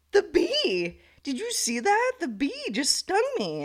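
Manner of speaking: high, stunned disbelief; shocked. Retold or imitated. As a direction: blurt it out in shock at the sting. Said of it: "'cause I'm so, like, shocked".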